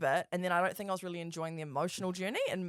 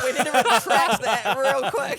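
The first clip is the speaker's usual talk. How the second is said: laughing; 5 semitones higher, 12 dB louder, slower than usual. Real, not acted. Laughing at defaming a friend.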